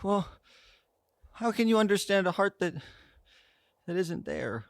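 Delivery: high, halting, pleading voice; hesitant high-pitched figure; Love. Acted. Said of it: "You see Love go".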